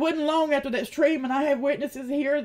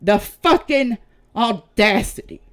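outraged; 2 semitones lower, 6 dB louder than usual. Real, not acted. Outraged at accusers.

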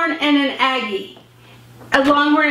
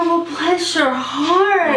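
pitying bless your heart; much slower than usual. Shown as a demonstration. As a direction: say it like something bad just happened. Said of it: "It's all about inflection".

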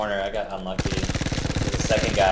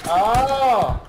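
surprised yelp; 7 dB louder than usual. Real, not acted. Sudden event in game.

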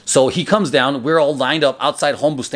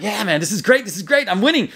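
excited boast; 6 semitones higher than usual. Retold or imitated. Retold excited thoughts while winning.

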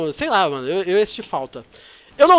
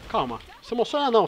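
ordinary delivery

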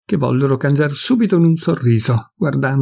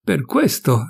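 slow, high knowing exclamation; a fat old man's pleased "aha"; exclaiming; 6 semitones higher, slower than usual. A narrator's reading. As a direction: draw it out as a sudden realisation. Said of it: "esclamò l'altro".

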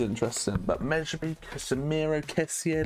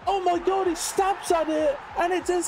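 excited shout; 10 semitones higher than usual. Real, not acted. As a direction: shout it out as the goal goes in. Goal celebration in commentary.

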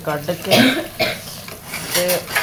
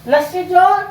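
mock shout; 11 semitones higher than usual. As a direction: shriek at the lassi thief. Mother yells at lassi thief. (ਉੱਚੀ ਮਜ਼ਾਕੀਆ ਚੀਕ)

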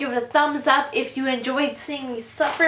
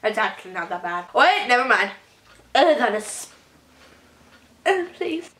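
talking while chewing; disgusted with food in mouth; mouth full. Real, not acted. Seen 1: Bit cracker at -2; chewing, grimacing while talking until about 5s.

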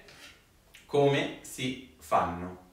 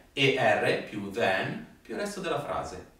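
repeat after me; 4 dB quieter than usual. Shown as a demonstration. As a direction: recite it for the class to repeat. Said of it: "Ripetete con me". (ripetizione scandita in classe)

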